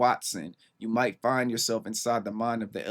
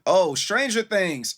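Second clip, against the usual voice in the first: surprised; 8 semitones higher, 6 dB louder, slower than usual. Real, not acted. Suddenly gets the lyric reference.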